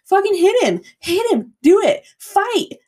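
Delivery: put-on drunk screaming. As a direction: act out egging on a fight. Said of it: "and I'm screaming in this guy's face".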